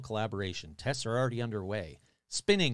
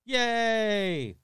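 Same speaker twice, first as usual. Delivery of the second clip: sarcastic cheer; 8 semitones higher, 5 dB louder than usual. Pretend. Sarcastic cheer at deadly virus.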